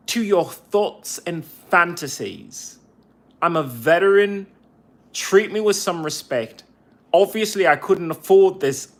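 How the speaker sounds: angry retort. Retold or imitated. Veteran snapping back at harassing VA employee, loud and furious. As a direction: tell him off at full volume.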